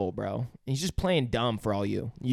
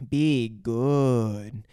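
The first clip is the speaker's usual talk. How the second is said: slow, drawn-out alien voice; 3 semitones lower than usual. Retold or imitated. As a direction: echo it slowly as the alien. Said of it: "And then".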